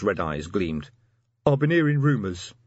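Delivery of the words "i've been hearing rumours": troll voice. A narrator's reading.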